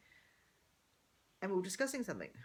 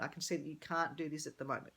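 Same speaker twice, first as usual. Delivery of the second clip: normal talking voice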